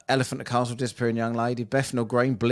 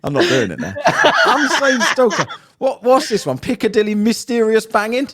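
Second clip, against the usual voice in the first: laughing; 10 semitones higher, 7 dB louder than usual. Real, not acted. Laughing through reading.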